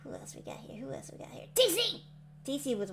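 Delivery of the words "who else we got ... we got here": soft whispered aside; whispering. Real, not acted. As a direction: whisper it to yourself. Scanning chat, whispered aside.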